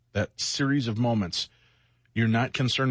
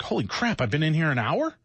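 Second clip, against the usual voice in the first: higher-pitched comic disbelief; mock shock; 5 semitones higher than usual. Phone scroller realizing an hour passed, mock shock. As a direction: act suddenly startled by the lost time.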